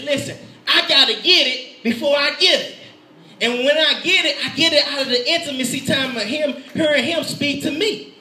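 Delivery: high, urgent pulpit delivery; forceful preaching. Real, not acted. Preacher asking if God's word condemns or liberates, forceful preaching. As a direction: press each question on the congregation.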